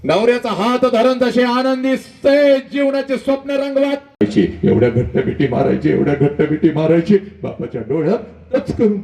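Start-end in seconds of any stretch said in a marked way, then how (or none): 0.0-4.5 impassioned oration. Acted. Passionate oration peak.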